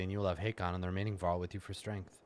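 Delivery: ordinary talk, nothing marked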